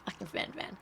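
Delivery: breathy whispered aside under the breath; a quiet whispered joke at the mix-up; unvoiced aside. Real, not acted. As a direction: whisper it to yourself, amused. Muttered joke after mishearing.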